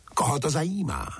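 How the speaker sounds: high, quick, dismissive interjection; acted snooty outburst, pitched well up; haughty, envious retort. A narrator's reading. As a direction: cut in like a proud, envious queen. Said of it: "ozvala se náhle pyšná a závistivá".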